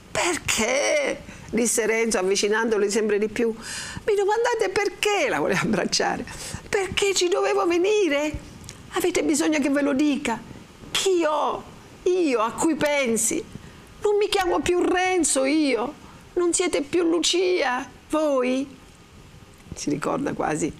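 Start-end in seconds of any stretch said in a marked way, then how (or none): 0.1-1.2 high, incredulous "why"; indignant echo. Acted. Renzo echoes Lucia's question, stung and incredulous. 3.5-5.3 very high, drawn-out protest; incredulous indignation. Acted. Renzo challenges Lucia's question, high and incredulous. 6.7-18.7 high, drawn-out lover's pleading; impassioned protest. Acted. Renzo declares himself to Lucia, hurt and fervent.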